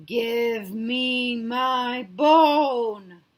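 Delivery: very slow eerie ghost moan.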